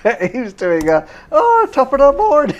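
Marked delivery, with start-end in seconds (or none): 1.3-2.6 put-on high, loud stage-Irish accent; high, loud mock Irish accent. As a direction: ham up a cartoon Irish greeting.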